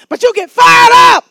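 explosive revival yell; rousing preacher shout. Real, not acted. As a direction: shout it to fire up the room. Preacher firing up congregation.

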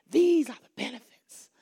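hushed whisper on the line; a sudden hushed preacher's whisper; whispered aside. Real, not acted. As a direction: drop to a whisper for the benefits.

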